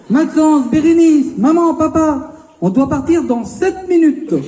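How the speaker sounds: put-on high-pitched child rounding up the family; little stationmaster. Daughter as a child hurrying parents and siblings, mock stationmaster call. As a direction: call out like a bossy little timekeeper, pitched well up. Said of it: "te surnommaient le petit chef de gare".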